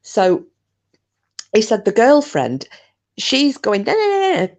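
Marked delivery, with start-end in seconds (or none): 3.8-4.5 high mock panicked no's; mock panicking. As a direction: imitate her panicking, much higher. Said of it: "proper panicking".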